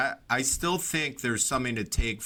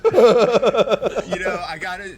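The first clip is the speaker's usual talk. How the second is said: words spoken through real laughter, higher and louder; laughing through words; 9 semitones higher, 11 dB louder, much slower than usual. Real, not acted. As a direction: talk while still cracking up. Still laughing.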